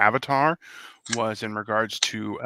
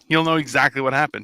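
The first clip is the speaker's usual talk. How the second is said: ordinary talk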